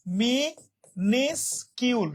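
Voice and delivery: মিনিসকিউল very slowly as mi, nis, kyul; syllable by syllable. Shown as a demonstration. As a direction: separate the three parts of মিনিসকিউল with clear pauses. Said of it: "উচ্চারণটা হবে এরকম".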